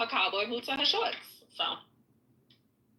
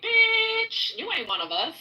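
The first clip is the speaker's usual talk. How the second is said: mock Texan retort; 10 semitones higher, 4 dB louder, slower than usual. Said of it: "a true Texan will look at me like".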